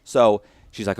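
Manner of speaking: usual speaking voice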